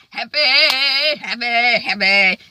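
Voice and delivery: a lilting, giddy repeat of happy; sing-song it like pure delight. Retold or imitated. Mary Jane overjoyed about Justin.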